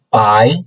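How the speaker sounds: BAI rising from low to high; second tone. Shown as a demonstration. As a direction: lift the pitch through BAI. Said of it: "From a lower point to a higher point".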